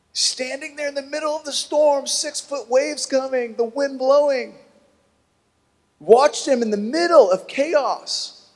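high, rising preacher's build; preaching at full force; soaring sermon. Acted. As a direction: paint the storm scene with fervour. Preaching climax over the storm.

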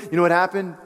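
ordinary talk

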